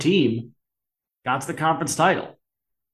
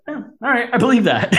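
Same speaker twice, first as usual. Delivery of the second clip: sarcastic disbelief; 9 semitones higher than usual. Retold or imitated. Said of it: "It's like".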